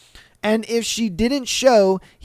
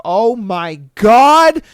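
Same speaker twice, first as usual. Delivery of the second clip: loud, drawn-out cry of shock; horrified exclamation; 8 dB louder, slower than usual. Acted. Diner seeing a man land outside the restaurant, horrified. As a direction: act out slow, rising horror, loud on the last word.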